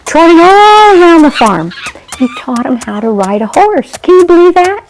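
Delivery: wow the little listeners; excited narration. A narrator's reading. Narrator marvels to children.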